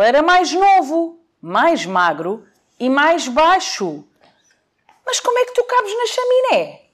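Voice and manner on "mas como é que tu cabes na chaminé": high squeaky wolf question; wolf doubting Santa. Acted.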